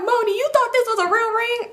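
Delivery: very high-pitched mocking disbelief; hammed-up incredulous question; imitated teasing. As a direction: ridicule her in a put-on squeaky voice. Relatives mock her in story.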